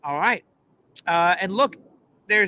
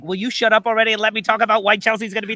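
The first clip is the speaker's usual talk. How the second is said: louder, rushed imitation of impatience; impatient mock outburst; 2 semitones higher, 5 dB louder, much faster than usual. Voicing Najee wanting his turn, mock-impatient rush. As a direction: rattle it off fast, mock-exasperated. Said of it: "Najee's just like".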